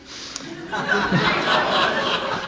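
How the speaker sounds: real burst of laughter; breathy, amused laughing fit. Real, not acted. Laughs at her own advice.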